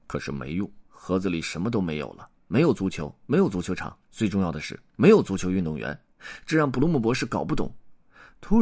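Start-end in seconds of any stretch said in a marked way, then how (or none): none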